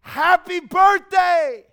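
high, loud and stretched out; excited scream. Acted. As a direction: belt it with pure joy. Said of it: "so much excitement in that statement".

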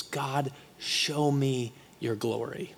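slow, soft, reverent request; hushed plea. Retold or imitated. Moses asking God to show his glory, hushed. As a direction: ask it softly, word by word.

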